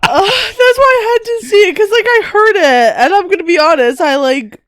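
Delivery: high, loud voice on the edge of tears; real tearful. Real, not acted. Wife explaining why she reread his story, tearful. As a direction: talk through rising tears. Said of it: "making me cry so much".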